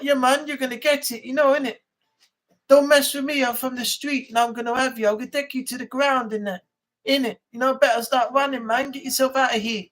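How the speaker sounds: swagger like a London roadman; mock London street accent. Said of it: "all this London stuff".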